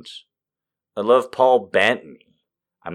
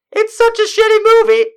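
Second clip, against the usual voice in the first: much higher, louder gleeful squeal; excited squeal; 7 dB louder than usual. Real, not acted. Fan raving about a bad movie she loves, squealing. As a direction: shriek it with delight.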